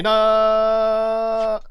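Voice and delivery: long, loud, high "no"; dismayed cry. Real, not acted. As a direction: wail it out, holding the vowel. Mistake in pressing a button.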